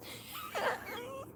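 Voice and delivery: high, soft squeal; squealing laugh. Real, not acted. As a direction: giggle. Laugh at the fumes.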